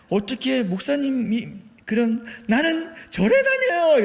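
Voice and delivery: high, loud booming auntie voice; booming Jeolla lady. Retold or imitated. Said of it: "쩌렁쩌렁하게". (높고 큰 쩌렁쩌렁한 아주머니 목소리)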